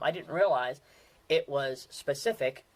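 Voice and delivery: ordinary talk, nothing marked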